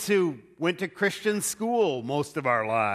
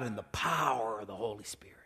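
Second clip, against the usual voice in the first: whisper; 7 semitones lower, 10 dB quieter, faster than usual. Retold or imitated. Paul's reverent closing words.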